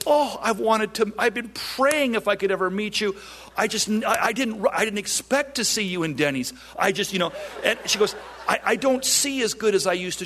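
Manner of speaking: put-on a breathless eager old woman gushing; voicing an elderly lady. Retelling a ninety-one-year-old lady's words.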